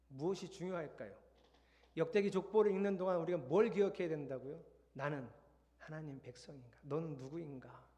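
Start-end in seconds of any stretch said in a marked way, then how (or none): none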